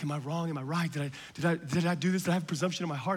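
low, soft acted self-questioning; quiet, stumbling inner doubts; anxious self-doubt. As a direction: worry at it under your breath. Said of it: "And you have anxiety. You're like".

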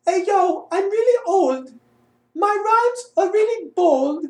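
high falsetto British rap. Acted. Auditioner retries the rap for casting team, high British accent. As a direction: recite the rap in high, mock-refined British. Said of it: "A little bit more British".

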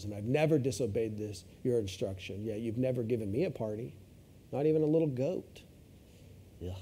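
low, quiet, bitter complaint; older son sulking at his father; resentful older son. Retold or imitated. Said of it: "He just says".

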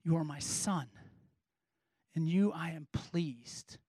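very low, soft, almost whispered; Father's voice from heaven. Acted. God speaking over Jesus at baptism, hushed near-whisper. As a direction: drop to a slow, reverent hush. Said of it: "A voice".

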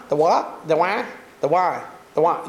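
"the why" as "the wa", the diphthong flattened Southern style. Shown as a demonstration. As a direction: say why as a long flat wa. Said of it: "you hear it? The why?".

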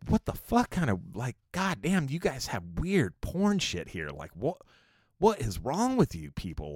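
mock outrage at the strange pictures; exasperated disbelief. Reacting to weird bathroom pictures.

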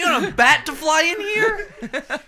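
loud, high, slowed protest with a laugh; loud sharp voice. Real, not acted. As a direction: shout it back, half laughing. Arguing over open window.

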